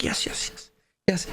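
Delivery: mumbled yes yes under the breath; whispered drunk yeses. Retold or imitated. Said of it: "he's like".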